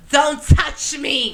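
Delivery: slow, firm don't touch me; a child's tough warning; stern warning. Retold or imitated. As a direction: warn her off, slow and hard. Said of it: "rips the orange out of her mouth and goes".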